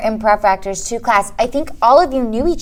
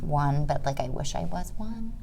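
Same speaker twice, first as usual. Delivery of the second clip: whisper; 4 semitones lower, 12 dB quieter than usual. Real, not acted. Guest aside about being twenty-two.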